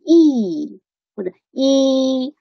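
"he" with a long, drawn-out ee; long vowel HE. Shown as a demonstration. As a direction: hold the vowel in "he". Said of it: "他本来是一个长元音". ("he"读长元音，拖长)

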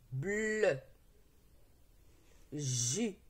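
each syllable isolated, first consonants held long; teacher modelling syllables for repetition, drawn out and firm; syllable reading drill. Shown as a demonstration. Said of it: "C'est parti pour la lecture des syllabes". (lecture de syllabes isolées, consonnes étirées, bien appuyées)

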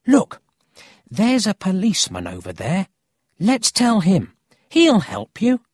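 put-on high, loud, excited boy; eager urging. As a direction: urge your friend to act now. Boy spots a policeman, urges Emil.

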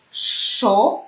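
"szu" with a long hushing sz before the vowel; drawn-out SZ. Shown as a demonstration. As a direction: model the syllable "szu", sz held long.

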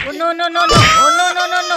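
high, loud string of no's, peaking in the middle; panicked no. Pretend. Watching Spider-Man strike near the baby, loud panicked no's. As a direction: cry out no in mock alarm.

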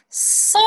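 "ソ" with a long hissed s first; breath flowing through s. Shown as a demonstration. Teacher demonstrating the voiceless s, "ソ" with a drawn-out hiss. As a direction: let air flow before the vowel of "ソ". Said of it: "無声シーンに息を流して".

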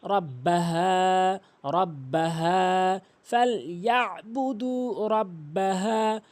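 slow chant, final haa held; measured recitation of ফালইয়াবুদু রাব্বাহা; model recitation. Shown as a demonstration. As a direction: stretch the last vowel of রাব্বাহা with an easy h. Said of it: "সহজভাবে উচ্চারণ করতে হবে".

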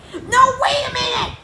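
very high, loud cry; Harold objecting. Acted. Harold trying to stop Miss Anthrope, high and loud. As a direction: call out high to stop her.

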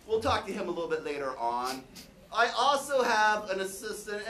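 ordinary delivery

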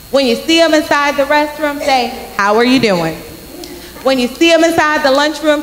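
normal talking voice

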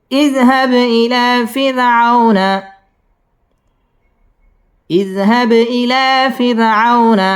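slow, drawn-out tajweed chant; measured Quranic recitation. Shown as a demonstration. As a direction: recite slowly, stretching the long vowels. Teacher recites the verse.

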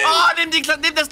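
high, loud hype yell; frantic caster cheer; excited shout. Real, not acted. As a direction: scream the play home. Teamfight climax.

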